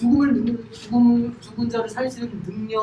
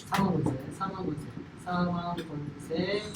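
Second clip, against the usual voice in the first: drawn-out hush; 5 semitones lower, 10 dB quieter than usual. Real, not acted. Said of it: "repeated softly, stretched".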